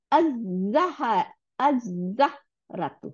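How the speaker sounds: "আজ-জাহরাতু" very slowly with a long Z; teacher's slow model of "আজ-জাহরাতু", Z held; doubled Z. Shown as a demonstration. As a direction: draw out the Z in "আজ-জাহরাতু", no L sound. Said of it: "লাম আর উচ্চারণই করি না".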